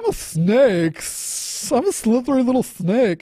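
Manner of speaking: high hissing snake voice. Shown as a demonstration. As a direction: slither the words like a snake. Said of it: "Okay, here's my".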